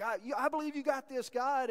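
bold excited Peter. Retold or imitated. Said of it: "boldly jump".

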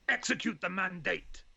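gruff barked parade order; barked command. Acted. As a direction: bark it like a commander. Podcast intro battle command.

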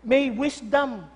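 ordinary delivery

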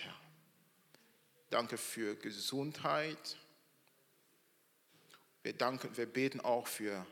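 soft, hushed prayer voice. Acted. Pastor praying for the new families, soft and devout. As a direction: pray gently over the families.

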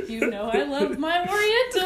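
hammed-up rug lover; imagined friend. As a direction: gush as the friend, through laughter. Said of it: "I can picture his friend coming down like".

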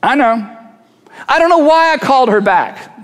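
a lightly voiced puzzled self; mock confession. People puzzling over their own choices, mildly baffled. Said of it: "Jeremiah says".

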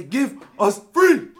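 high, slow, very loud cry of give us free; defiant shout. Acted. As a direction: bellow the demand in accent. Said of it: "They touch me. I say".

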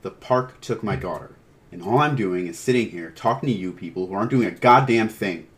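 forceful outburst, building in volume; angry shouting. Acted. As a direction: let frustration boil over at them. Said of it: "John shouted, standing up".

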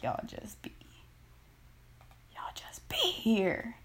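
soft, voiceless and slow; whisper. Real, not acted. Venting about men hollering, in a whisper. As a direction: let it drop to a whisper.

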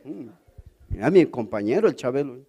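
normal talking voice

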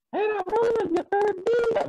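very high-pitched mock call; hailing greeting. Pastor brother greeting him as he parks at work, hailing. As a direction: imitate a brother calling out in a high voice.

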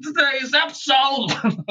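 put-on high, slow mumbling old man; mock Cosby rambling into a radio; Bill Cosby impression. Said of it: "radio back and be like".

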